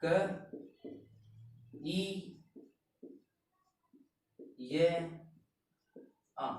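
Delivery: क, ई, य, आ spaced with long pauses; sound by sound. Shown as a demonstration. Teacher splits किया for pupils, slowly sound by sound. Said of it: "यानी कि".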